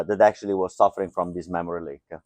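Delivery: ordinary talk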